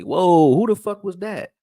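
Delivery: loud, high "whoa" of disbelief; amazed exclamation. Retold or imitated. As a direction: blurt it out as if stunned by a big play. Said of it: "make you look at him and say".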